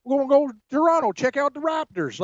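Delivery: a lightly hammed-up dad pitching a trip; imitating Dabo to family. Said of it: "You know what, huh".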